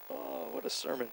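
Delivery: ordinary talk, nothing marked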